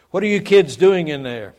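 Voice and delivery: a mother yelling through a closed door; mother calling through door. Retold or imitated. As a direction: call out to the kids behind the door.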